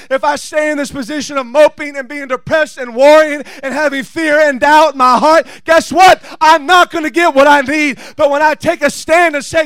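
firm declaration building to a shouted climax; impassioned, driving sermon delivery; fired-up preaching. Real, not acted. Preaching builds to full force.

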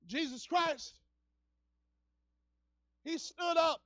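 ordinary talk

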